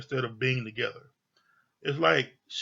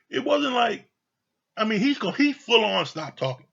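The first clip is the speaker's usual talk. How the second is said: raised, louder, driving the point home; insistent; 8 semitones higher, 5 dB louder than usual. Real, not acted. Insisting to viewers he had fully stopped, emphatic and raised.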